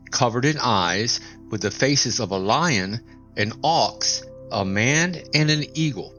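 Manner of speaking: ordinary delivery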